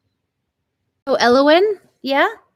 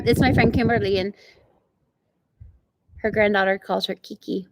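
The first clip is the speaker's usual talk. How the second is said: ordinary talk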